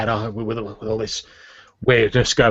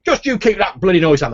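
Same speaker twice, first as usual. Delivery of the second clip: higher, louder parting scolding; blustering official; 7 semitones higher, 5 dB louder than usual. Acted. Fat official climbing stairs warns guards about noise, irritated. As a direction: fire a pompous warning back at them.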